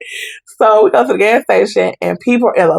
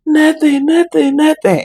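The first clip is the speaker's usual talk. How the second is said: mom's denial; 8 semitones higher than usual. Retold or imitated. Mother denying her limp hand.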